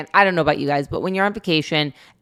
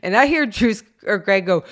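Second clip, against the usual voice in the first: ordinary delivery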